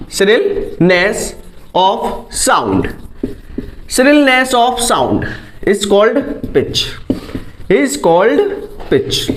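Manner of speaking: very slow, word by word for notes; slow dictation. Shown as a demonstration. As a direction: dictate it slowly so students can write. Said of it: "dictating definition for notes". (बहुत धीरे लिखवाते हुए)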